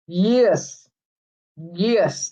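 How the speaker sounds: ordinary delivery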